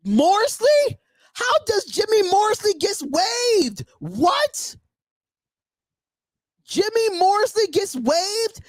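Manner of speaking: high, slow, disbelieving questions; can't believe the news, drag it out in shock; stunned disbelief. Real, not acted. Shocked at a player being cut.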